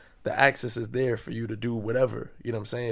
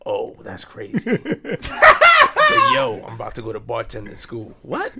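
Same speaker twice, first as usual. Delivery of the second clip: laughing mockery; 5 semitones higher, 11 dB louder than usual. Mocking a wannabe entrepreneur.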